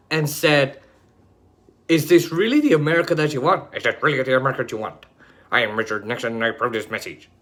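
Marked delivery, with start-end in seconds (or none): 3.7-5.1 rapid, lower Nixon voice; Richard Nixon impression. Mocking Nixon's ad for viewers, rapid Nixon voice. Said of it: "that's literally how he talked". 5.5-7.3 rapid, lower ad sign-off as Nixon; a quick mock Nixon approval line; Richard Nixon impression. Parodying Nixon's ad tag, rapid Nixon voice. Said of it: "that's literally how he talked".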